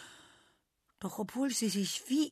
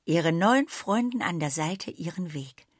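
ordinary delivery, nothing marked

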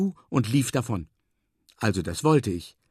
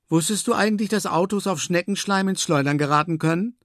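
much higher, amazed question; called-out question; 8 semitones higher than usual. A narrator's reading. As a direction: call it out, astonished by the news. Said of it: "ruft Moma". (erstaunt gerufene Frage)

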